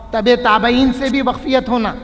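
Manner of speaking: ordinary delivery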